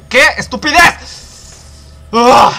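high, slow, drawn-out cry of frustration; exasperated outburst. Real, not acted. Gamer fed up with the level mid-story, exasperated outburst. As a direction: burst out in exasperation, ending in a groan.

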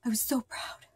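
breathy whispered confession; a real whisper of sad pride. Real, not acted. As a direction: drop to a whisper, sadly.

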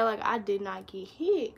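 ordinary talk, nothing marked

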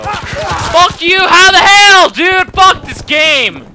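high, very loud rage at the game; furious yell. Real, not acted. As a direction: scream it at the screen in real anger. Gamer rages at being killed.

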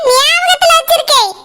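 ordinary talk

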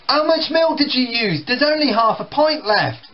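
put-on annoyed teenager. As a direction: snap back, fed up. Brothers quarrel in acted scene.

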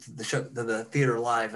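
ordinary talk, nothing marked

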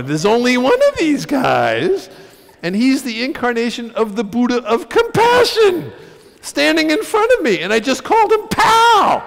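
loud, climbing to a high yell; aghast outburst. Retold or imitated. Comic realizing he called the Dalai Lama pal, aghast. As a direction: build to hysterical disbelief at your blunder. Aghast at his own blunder.

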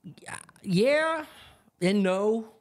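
high, drawn-out hemming with long pauses; mock indecision, voice pitched well up; hedging. Imitating neighbor asked if the area is better, hedging.

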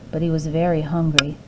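ordinary delivery, nothing marked

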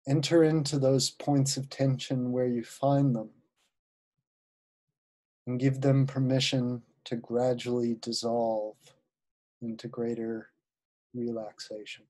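unhurried guided-visualization voice with long pauses; guided meditation. Acted. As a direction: ease listeners into relaxation, phrase by phrase.

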